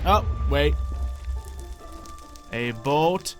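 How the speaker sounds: ordinary delivery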